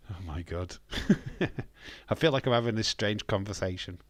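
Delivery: usual speaking voice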